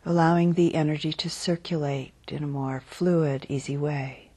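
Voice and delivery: unhurried, calm body-scan voice; guided meditation. Real, not acted. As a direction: guide them gently into settling, phrase by phrase. Leads a guided body-awareness meditation.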